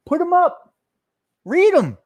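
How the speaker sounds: loud, high-pitched demand; heated challenge. Real, not acted. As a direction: throw it out as a sharp dare. Heated challenge to rival content creators.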